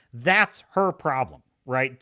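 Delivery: usual speaking voice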